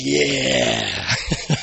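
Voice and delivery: put-on a low, drunken "yeah"; tipsy grin. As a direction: lightly play the tipsy wife grinning. Wife grinning, tipsy reply.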